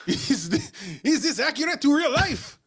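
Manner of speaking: high mock French voice; a hammy French-accented question. Asks in same mock voice.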